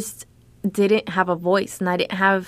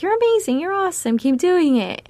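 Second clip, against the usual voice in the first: much higher, bubbly and cheering; gushing encouragement; 10 semitones higher than usual. Retold or imitated. Editor cheering on a new writer, high and gushing. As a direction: hype her up like a cheerleader. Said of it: "she kept encouraging and was like".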